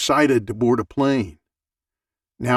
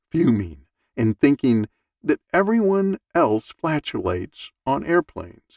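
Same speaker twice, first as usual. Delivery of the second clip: ordinary delivery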